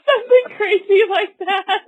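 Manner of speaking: high, loud laughing speech; cracking up while talking. Real, not acted. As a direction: squeal it out through laughter. Joking about ridiculous ads, laughing.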